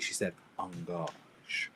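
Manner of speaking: engage said slowly in a soft French way, like ongahzh. Retold or imitated. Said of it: "instead of saying engage".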